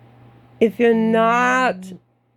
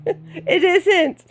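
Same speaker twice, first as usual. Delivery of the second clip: laughing; 8 semitones higher than usual. Real, not acted. Laughing through a teasing disclaimer.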